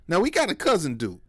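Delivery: ordinary talk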